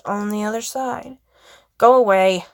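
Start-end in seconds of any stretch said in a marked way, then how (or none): 1.8-2.5 curt, louder dismissal; short and brusque. A narrator's reading. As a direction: send them away curtly, a little louder. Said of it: "whose curt voice carried".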